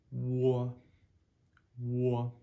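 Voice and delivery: "wa" high and loud, lips rounding into open ah; loud model syllable. Shown as a demonstration. Teacher drilling the sound, high and loud. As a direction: push out a clear "wa" for them to copy. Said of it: "a standalone O is said".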